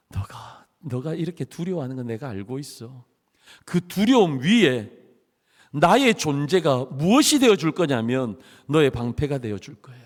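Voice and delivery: hushed loving God voice; tender reassurance. Acted. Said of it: "그렇게 책망하지 않으세요".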